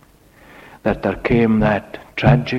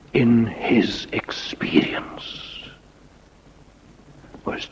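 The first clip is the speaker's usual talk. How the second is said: hushed whisper; 6 dB quieter than usual. Acted. Sermon drops to hush.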